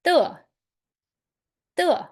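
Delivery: falling fourth-tone "the", sharp and high. Shown as a demonstration. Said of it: "that will be".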